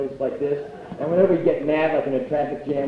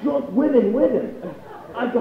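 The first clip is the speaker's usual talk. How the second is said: ordinary delivery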